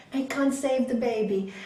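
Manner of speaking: ordinary talk, nothing marked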